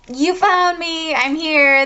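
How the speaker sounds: high, drawn-out, dramatic announcement; jumping-out call. Acted. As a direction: leap out with a long high call. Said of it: "finally jumps out".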